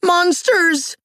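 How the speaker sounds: very high little character's cry of alarm. A narrator's reading. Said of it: "Little Toot cried out".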